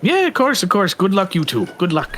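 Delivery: a high, eager send-off, lightly acted; obliging helper character. Game master character wishes players luck.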